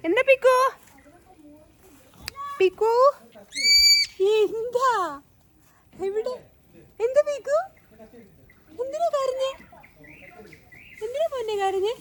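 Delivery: high, loud cooing call to a pet. Real, not acted. Owner calling to the crying pet, high cooing baby talk. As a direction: coo up high to comfort the little one.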